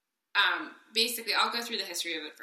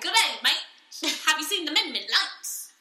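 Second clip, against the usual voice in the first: mock Australian; 8 semitones higher, 6 dB louder than usual. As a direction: fake Australian asking about the lights. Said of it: "That is not Australian at all".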